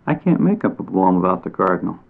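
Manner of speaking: ordinary delivery, nothing marked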